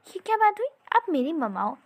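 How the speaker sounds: indignant outburst. Acted. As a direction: cry out as if wronged. Son protests mother praises rival.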